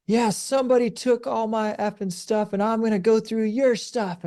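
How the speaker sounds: high, mock-furious ranting stranger; imitating a woman cursing about her stolen stuff; angry cussing woman. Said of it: "And she said".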